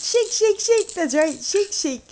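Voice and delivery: very high sing-song coaxing. Real, not acted. Singsong to baby.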